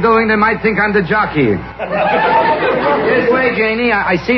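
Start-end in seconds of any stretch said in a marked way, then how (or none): 1.6-3.0 high laugh. Acted. Laughs at jockey joke.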